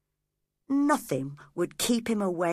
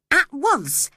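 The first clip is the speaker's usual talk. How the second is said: ordinary delivery, nothing marked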